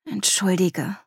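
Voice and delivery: whispered, murmured apology; quiet murmur. A narrator's reading. Natalia apologising to her friend after snapping, murmured quietly. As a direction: murmur it under your breath. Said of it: "murmelte Natalia leise".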